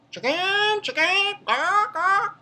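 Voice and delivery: very high-pitched chicken taunt; mock rooster squawk. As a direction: squawk it like a silly bird. Mocks rooster with bird calls.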